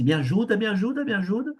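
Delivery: high, desperate begging, imitated; pleading for help. Man short of breath begs the pastor, desperate plea. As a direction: beg as if you can't breathe. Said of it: "começou a te puxar assim".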